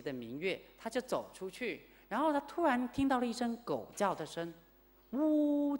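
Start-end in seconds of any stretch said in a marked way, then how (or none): 5.1-5.8 high, drawn-out dog howl; imitating a whimpering dog's howl; mournful dog howl. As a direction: howl like a miserable dog in the night. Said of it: "叫得很凄惨".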